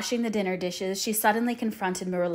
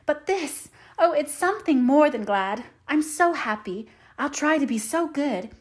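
put-on high-pitched, gushing happy child; brimming, emotional delight; overjoyed; 5 semitones higher, 5 dB louder than usual. A narrator's reading. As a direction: overflow with gladness. Overjoyed child accepted into home.

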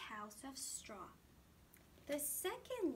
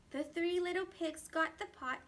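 ordinary delivery, nothing marked

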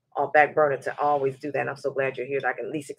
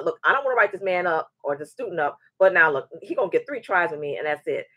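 normal talking voice

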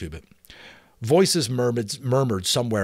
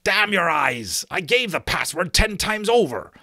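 loud, higher angry outburst; raging yell; 10 semitones higher, 5 dB louder than usual. Acted. Giant protesting to the sentry who shot at him, raging yell. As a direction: yell it in a rage. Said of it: "yelled with rage".

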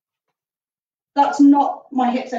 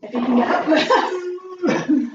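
laughing tease; much slower than usual. Real, not acted. Teasing partner getting up.

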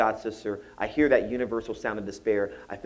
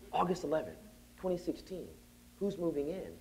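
soft, higher thinking aloud; hushed pondering; 5 semitones higher, 11 dB quieter than usual. Retold or imitated. Professor puzzling over the date, acted hushed wondering. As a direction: puzzle it out quietly, pitched up.

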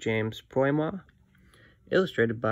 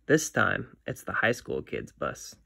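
plain reading voice

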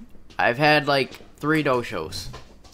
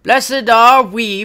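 loud, higher, slow pronouncement; stagey declaiming; 5 semitones higher, 10 dB louder, slower than usual. Acted. Traveler giving thanks to his brother, stagey declaiming. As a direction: declaim it like a pilgrim.

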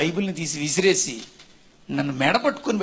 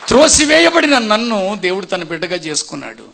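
louder repeat stressing త్రోసివేయబడిన; forceful preaching; 2 semitones higher, 9 dB louder than usual. Acted. Preacher to the congregation, a louder, rising proclamation. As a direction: build the repeat into a loud proclamation.

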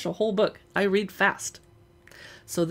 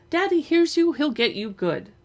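higher, louder warning whisper-turned-plea; a hint of a worried brother; 7 semitones higher, 4 dB louder than usual. Perry warning his sister about Daddy, lightly higher. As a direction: warn her off before Daddy hears. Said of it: "Perry said".

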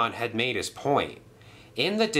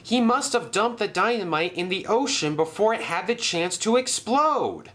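relieved Thomas; 10 semitones higher than usual. Acted.

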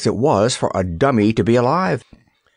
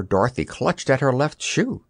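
ordinary delivery

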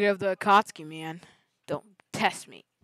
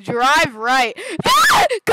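panicked shouting; 6 semitones higher, 9 dB louder, slower than usual. Real, not acted. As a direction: laugh breaking into a high scream. Yelling at a bad driver in game.

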